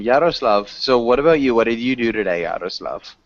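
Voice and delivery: usual speaking voice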